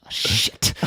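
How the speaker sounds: whispered curse on being caught; whispered dismay. Retold or imitated. Comedian recognised by a stranger, whispered dismay. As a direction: let it slip out in a whisper.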